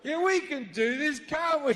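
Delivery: lightly acted, high-pitched pep; rally the group with put-on keenness; mock eager rallying. A crowd agreeing to sing for God, mock eager rallying.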